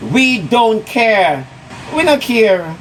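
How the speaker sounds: very slow, drawn out; slow defiance. Real, not acted. Telling Annie they don't care, slowly. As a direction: stretch each word defiantly.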